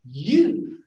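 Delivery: "you" said high and loud; stressed you. Shown as a demonstration. Teacher shows how a stressed "you" sounds. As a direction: punch the word "you". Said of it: "It would really be".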